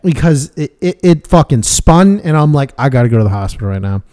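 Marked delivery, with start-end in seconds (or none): 2.8-4.0 urgent low quote. Retold or imitated. Recalling telling a friend he needs the hospital, low and rushed. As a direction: rattle it off low and fast in a hurry. Retelling his urgent torsion moment.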